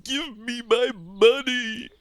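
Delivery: high, loud, slow wailing plea; sobbing plea. Acted. Lordship on his knees begging for money, sobbing wail. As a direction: wail it like a broken man begging. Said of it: "falls to his knees sobbing".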